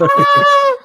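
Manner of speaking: very high, loud shocked squeal; mock outraged shriek. Reacting to a Southern insult with a high shocked squeal. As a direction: let out a sudden squeal of outrage.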